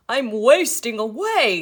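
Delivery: wolf whining loudly that he's starving; a hungry wolf's loud moan; complaining wolf. A narrator's reading. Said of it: "he complained".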